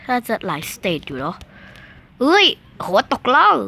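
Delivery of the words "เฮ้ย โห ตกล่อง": startled cry. Real, not acted. Character falls off.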